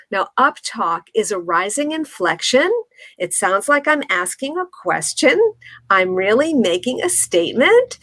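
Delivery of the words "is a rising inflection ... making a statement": statements rising like questions on INFLECTION, QUESTION and STATEMENT; uptalk. Shown as a demonstration. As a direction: lift the pitch at the end of each statement, as if asking. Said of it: "if you talk like that".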